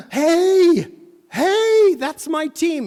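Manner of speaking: put-on missionary excitedly calling to a kid. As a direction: shout to the kid in delight.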